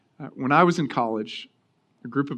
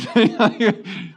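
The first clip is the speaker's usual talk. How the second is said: real laugh; 6 semitones higher, 4 dB louder than usual. Real, not acted. Pastor laughing at his friend's ring story, real laughter.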